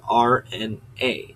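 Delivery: R N A as three separate slow letters; spelling out letters. Shown as a demonstration. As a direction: draw out R N A letter by letter. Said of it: "Like you're spelling out the letters".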